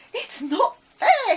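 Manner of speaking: high, loud and slow wail; upset shout. Acted. Boy alone on the field shouting, upset. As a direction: howl out the unfairness. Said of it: "he shouted".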